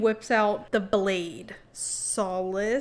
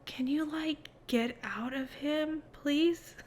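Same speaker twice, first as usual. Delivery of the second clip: whispering; 4 semitones higher, 6 dB quieter, slower than usual. Retold or imitated. Coaxing the sleeping Quicksilver.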